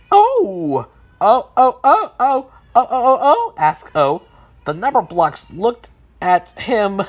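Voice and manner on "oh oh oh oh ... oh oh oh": loud, high string of ohs; excited ohs. Acted. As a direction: babble a question using only loud ohs. O excitedly asks using only oh.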